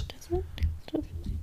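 breathy mock whisper. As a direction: whisper it conspiratorially. Whispered repeat.

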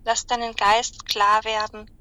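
slow, calm meditation voice; guided meditation. Acted. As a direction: guide them gently into relaxation. Guided animal visualization.

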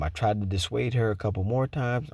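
ordinary delivery, nothing marked

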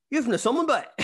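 put-on high, loud thick Northern Irish accent. Stranger in Singapore reads his jersey, thick Northern Irish accent. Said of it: "in the thickest accent".

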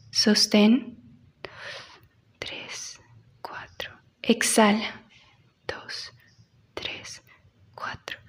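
counting breaths, fading to whisper; slow breath counting. Shown as a demonstration. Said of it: "leads breathing exercise".

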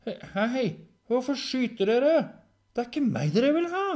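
very high, loud indignant voice; loud protest. Acted. Hunted dog protesting at the shooters, high and shouting. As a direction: call out in high-pitched protest.

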